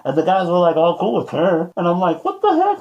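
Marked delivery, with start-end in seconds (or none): 2.2-2.8 shocked. Retold or imitated. Shocked at guys' friendliness.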